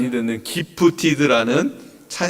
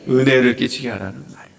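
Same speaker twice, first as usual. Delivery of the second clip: ordinary talk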